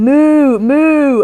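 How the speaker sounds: loud, high moo, like a cow, to the shop clerk; mock cow moo. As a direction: moo like a cow to get milk understood. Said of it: "So I started going".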